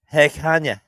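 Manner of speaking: lightly attempted Lithuanian accent, shy; light European accent. Guessing how Kanye's partner talks, light European accent. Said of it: "it's a very light, uh, European accent".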